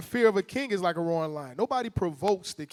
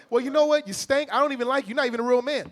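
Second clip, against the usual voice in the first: higher, louder jeering; mock provoking wife; 6 semitones higher, 5 dB louder, faster than usual. Mocking a wife provoking her husband, higher and louder. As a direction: throw the insults like a spiteful wife. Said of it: "Don't record nothing before that".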